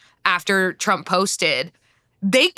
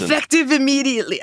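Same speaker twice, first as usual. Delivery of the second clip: hammed-up, quick mock-Austrian accent; accent attempt that slips into Austrian; 5 semitones higher, faster than usual. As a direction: put on a botched Austrian accent, rattled off. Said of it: "have you gone Austrian?".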